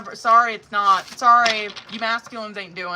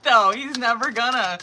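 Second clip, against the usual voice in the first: ordinary talk, nothing marked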